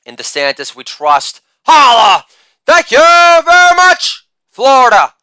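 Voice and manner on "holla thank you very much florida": loud "Holla", then a very high, loud "thank you very much"; hammed-up falsetto victory call; catchphrase shout. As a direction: belt out the catchphrase like a hype man.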